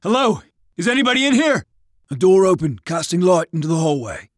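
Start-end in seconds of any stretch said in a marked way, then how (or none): 0.0-1.6 calling out. Acted. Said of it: "calling out".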